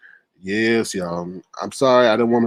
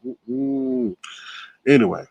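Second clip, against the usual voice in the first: usual speaking voice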